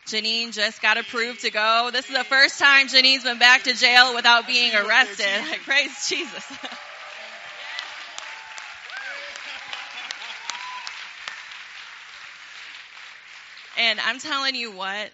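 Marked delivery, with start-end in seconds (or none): 0.1-6.5 much louder, higher joyful shout; excited shout. Real, not acted. Telling the congregation about Janine, joyful loud shout. 6.6-13.8 long high unvoiced laugh. Real, not acted. Congregation laughing at the Janine joke, long laugh.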